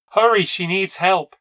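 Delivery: high, loud cry for help; urgent shout. A narrator's reading. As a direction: shout urgently to hurry someone along. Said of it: "she came and shouted".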